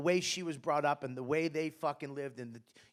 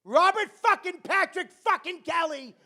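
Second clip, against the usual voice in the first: much higher, loud screaming mother; imitating furious mother; 12 semitones higher, 7 dB louder than usual. A mother screaming for her six-year-old son, high and loud. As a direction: scream the full name in fury. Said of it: "she'd go".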